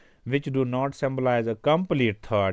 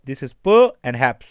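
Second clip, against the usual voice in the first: p and haps, slow and loud, p hit hard; stressed syllables; 8 dB louder, slower than usual. Shown as a demonstration. Teacher showing the class slow, loud stressed syllables. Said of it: "इस पे थोड़ा सा stress पड़ता है".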